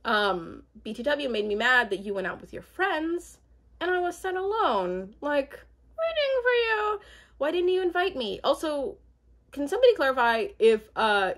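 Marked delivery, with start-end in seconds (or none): 0.8-8.4 high, whiny, tearful mock complaint. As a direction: whine it like a hurt girlfriend. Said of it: "Chelsea is like".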